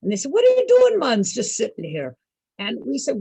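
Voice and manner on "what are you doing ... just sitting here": put-on Jamaican accent. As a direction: call out in an imitated Jamaican accent. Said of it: "They have great accents".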